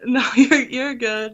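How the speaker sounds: ordinary talk, nothing marked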